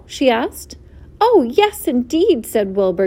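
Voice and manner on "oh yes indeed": high, delighted agreement; eager greeting. A narrator's reading. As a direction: burst out with an eager yes. Delighted pig greets new friend.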